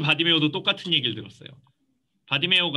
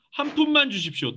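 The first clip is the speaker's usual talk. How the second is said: high-pitched beggar asking for coins; acted begging call; imitated beggar's plea; 10 semitones higher than usual. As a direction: plead in a raised voice like a beggar. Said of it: "바디메오는 이렇게 외쳤겠죠".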